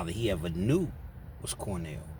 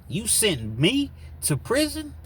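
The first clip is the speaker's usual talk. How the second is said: much higher, slower outraged question; incredulous accusation; 14 semitones higher, 5 dB louder, slower than usual. Retold or imitated. Husband to wife, slow, high outraged question. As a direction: hurl it back in disbelief.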